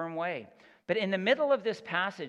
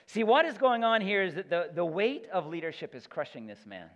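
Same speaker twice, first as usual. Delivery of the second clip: ordinary talk, nothing marked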